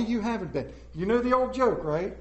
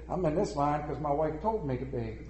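meek husband; 5 semitones lower, 6 dB quieter than usual. Retold or imitated. Henpecked man answers Saint Peter.

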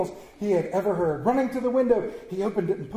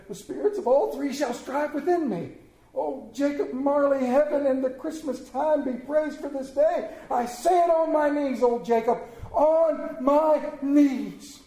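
high, exultant vow; joyful, raised-pitch praise; fervent gratitude; 6 semitones higher than usual. A narrator's reading. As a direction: give thanks with all your heart, drawing out the end. Scrooge gives fervent thanks.

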